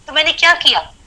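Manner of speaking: ordinary talk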